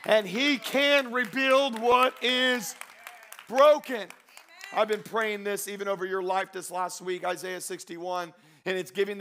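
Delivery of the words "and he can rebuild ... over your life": slow, loud build to a climax; impassioned preacher, words drawn out; full-force preaching. Real, not acted. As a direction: build to a high, ringing proclamation.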